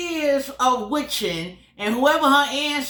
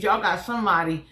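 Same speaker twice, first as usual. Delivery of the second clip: ordinary talk, nothing marked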